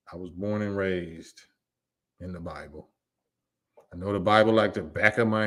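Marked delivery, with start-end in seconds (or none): 0.1-2.9 quiet fading repeat. Acted.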